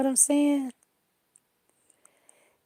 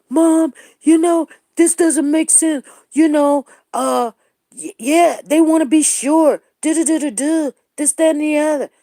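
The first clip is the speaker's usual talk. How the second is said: put-on excited kids voice; 6 semitones higher, 5 dB louder than usual. Imitating her kids.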